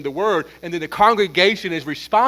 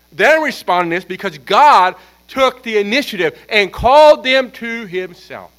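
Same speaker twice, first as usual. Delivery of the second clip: loud, emphatic sermon climax; forceful preaching; 3 semitones higher, 9 dB louder than usual. Real, not acted. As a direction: drive it home, slowing into a stretched finish.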